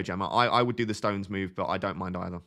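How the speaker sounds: normal talking voice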